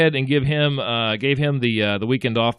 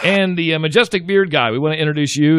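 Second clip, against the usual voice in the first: raised showman announcer voice; announcer intro; 5 semitones higher, 4 dB louder than usual. Acted. Host hyping the unseen bearded crew member, raised announcer voice. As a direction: sell him like the next act on stage.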